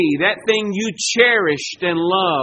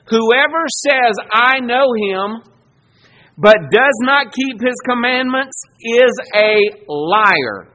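louder, slow, hammered-out verse; preaching scripture; 3 semitones higher, 6 dB louder than usual. Acted. Preacher thundering a verse at the congregation, slow and louder. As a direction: preach it out and drive each word home.

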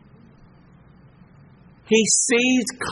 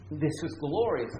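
much softer, lower, in wonder; hushed awe; 4 semitones lower, 12 dB quieter than usual. Real, not acted. Preacher marvelling at Jesus' answer, hushed. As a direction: breathe it in awe.

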